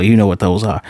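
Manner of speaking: normal talking voice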